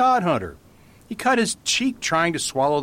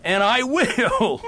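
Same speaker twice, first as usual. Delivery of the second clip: starting to laugh; 8 semitones higher, much slower than usual. Acted. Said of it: "I would like to laugh at myself".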